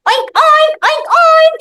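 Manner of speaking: high pig oinks; playful pig noise; oinking pig. Acted.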